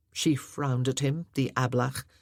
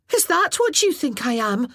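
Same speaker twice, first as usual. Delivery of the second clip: mad and hurt; 8 semitones higher, 7 dB louder than usual. Acted. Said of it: "feeling mad and hurt all over again".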